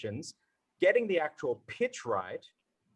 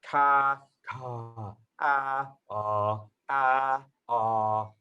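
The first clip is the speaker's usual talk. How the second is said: breathy dropped ka; much slower than usual. Shown as a demonstration. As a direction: let breath spill over "ka" and "ah". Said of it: "I breathe over that thing has dropped my voice".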